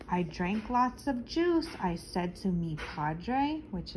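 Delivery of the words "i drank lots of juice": plain reading voice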